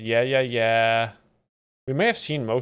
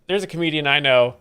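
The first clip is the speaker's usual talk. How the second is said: ordinary talk, nothing marked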